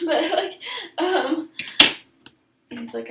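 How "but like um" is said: laughing. Real, not acted. Laughs at her own admission.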